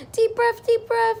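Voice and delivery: high, loud, rhythmic breathing cue; coaching nurse. Retold or imitated. Nurses coaching the mother's breathing, high loud cue.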